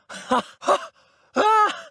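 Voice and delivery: breathless high laughs into a cry; exhilarated whoops. Acted. Game hero thrilled by the action, exhilarated whoops.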